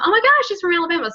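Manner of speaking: mock amazed listener. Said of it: "I was a full-time".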